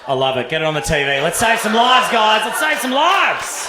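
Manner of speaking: loud, building to a shout; excited boss. Retold or imitated. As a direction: boss hyping the team over the idea. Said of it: "boss in the office".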